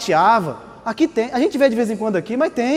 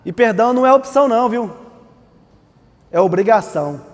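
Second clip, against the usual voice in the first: ordinary talk, nothing marked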